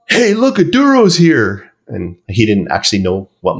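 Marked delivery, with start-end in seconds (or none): 0.1-1.7 mock excited call-out. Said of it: "this guy points at me and he goes".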